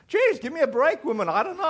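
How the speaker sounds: high-pitched comic exasperation; mock pleading to be left alone; exasperated. Preacher begging his questioner for a break, exasperated.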